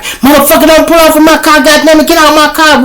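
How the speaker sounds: high, loud mock-angry screech; mock cussing out. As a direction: cuss him out way up high. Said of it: "cuss words at him".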